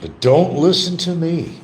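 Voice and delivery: high, stretched-out singsong; sing-song catchphrase. Pretend. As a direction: sing it out like a show title. Voices it as show title.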